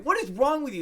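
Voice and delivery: high, loud mock outburst; T-Bone, angry. Player retells villager's reaction to the net attack, angry. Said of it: "he got an angry look".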